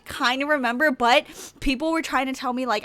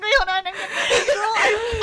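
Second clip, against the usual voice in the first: laughing to tears; 7 semitones higher than usual. Real, not acted. Laughing so hard she almost cries.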